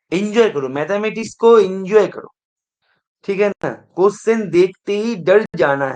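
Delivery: rallying students to enjoy maths; loud teacher. Real, not acted. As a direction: call it out to the whole room. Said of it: "तो इतना चिल्लाया मैंने".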